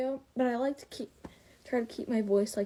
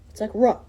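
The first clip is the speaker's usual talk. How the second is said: a loud low ruh; deep bark sound; 12 dB louder than usual. Shown as a demonstration. Showing viewers the first wolf noise, loud. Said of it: "start off with a noise".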